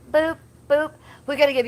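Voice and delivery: cute high boops; playful boops. Acted. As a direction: boop them cutely.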